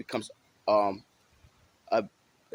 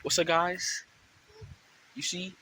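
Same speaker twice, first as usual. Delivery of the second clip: raised, higher voice; talking high; 6 semitones higher, slower than usual. Shown as a demonstration. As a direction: pitch the greeting up high. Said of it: "or talking high?".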